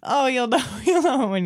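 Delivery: laughing through the words. Real, not acted. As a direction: chuckle while you say it. Laughing about bad laugh.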